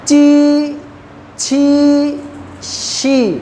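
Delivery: ची, छी, शी each said crisply and apart; ची छी शी as a teacher's drill; Chinese syllables demo. Shown as a demonstration. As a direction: sound out ची, छी, शी slowly for the class. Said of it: "कौन सा vowel लगाने लगा हूँ".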